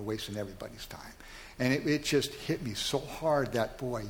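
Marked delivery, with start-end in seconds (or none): 0.0-1.1 whisper. Retold or imitated. God's words end in whisper.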